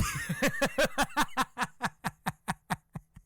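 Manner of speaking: high breathy gloating laugh; wife's cackle. Retold or imitated. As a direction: cackle in triumph. Wife laughs.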